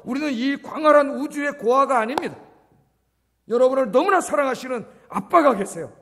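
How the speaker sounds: fervent loud proclamation; preaching at full force. Acted. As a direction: lift the congregation up. Preaching climax.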